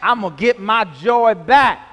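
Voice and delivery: loud, emphatic declaration; like meaning it. Shown as a demonstration. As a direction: declare it with full conviction. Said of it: "say it like you mean it".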